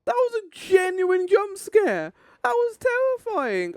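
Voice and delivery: high, slow shaken laugh; laughing off the fright; relieved laughter. Real, not acted. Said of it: "don't usually get that rattled".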